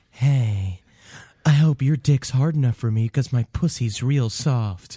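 lightly voiced phone sex worker; low, slow come-on, half in jest; phone sex operator. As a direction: play a bored sex-line woman. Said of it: "and they're like".

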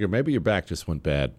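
ordinary talk, nothing marked

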